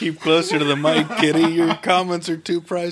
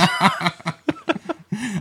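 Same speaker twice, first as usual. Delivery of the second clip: laughing; 7 semitones higher than usual. Real, not acted. Laughs at own joke.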